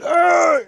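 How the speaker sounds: sudden high wordless yell; retold yell. Owner retelling how they stopped the runaway dog, high yell. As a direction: yell out a sharp, high "Arr!". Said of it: "I just yelled".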